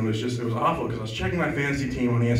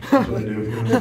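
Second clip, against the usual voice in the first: ordinary talk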